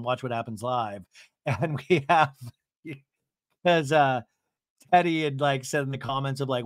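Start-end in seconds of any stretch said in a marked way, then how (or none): none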